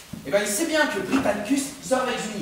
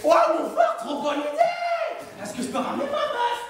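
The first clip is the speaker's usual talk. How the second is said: very high, slow squeal; squeaky Britannicus; slower than usual. Retold or imitated. Britannicus thrilled by his brother's invitation, very high squeal. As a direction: gush like a naive kid. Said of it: "Britannicus fait".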